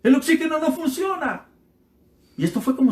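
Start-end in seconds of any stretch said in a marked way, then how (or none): 0.0-1.5 much higher mock desperate cry; old man crying out again in the night, higher mock yell; retold cry for help. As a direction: wail for help, pitched up like a frightened old man. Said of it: "volvió a gritar".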